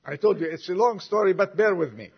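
ordinary talk, nothing marked

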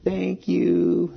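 high, drawn-out, bashful imitation of a child. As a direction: say it shyly, like a prompted kid. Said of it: "in this bashful kind of expression".

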